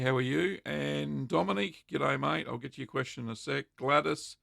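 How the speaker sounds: ordinary delivery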